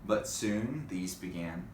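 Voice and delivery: plain reading voice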